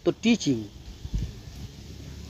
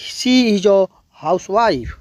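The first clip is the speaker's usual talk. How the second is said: very slow, every word spaced out; slow classroom demo; slow model sentence; much slower than usual. Shown as a demonstration. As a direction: spell it out slowly like a teacher. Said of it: "ऐसे बोलना है".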